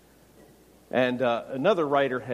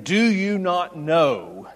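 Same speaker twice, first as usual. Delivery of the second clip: drawn-out question; 4 semitones higher, 7 dB louder, much slower than usual. Retold or imitated.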